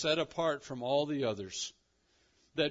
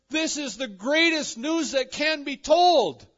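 excited proclamation; 13 semitones higher, 13 dB louder than usual. Acted. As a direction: proclaim it at full force like thrilling news. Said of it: "He is so excited about this good news".

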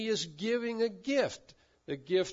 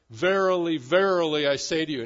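Verily, verily boomed out much louder; loud solemn declaration; 8 dB louder than usual. Retold or imitated. Pastor quoting the old translation, booming declaration. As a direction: ring it out like the old King James. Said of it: "with declaration".

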